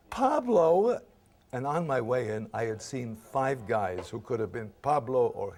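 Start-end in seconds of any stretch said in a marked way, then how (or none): 0.1-1.0 high, loud incredulous question. Retold or imitated. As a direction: echo the name in mock disbelief. Retold disbelief at the audition offer.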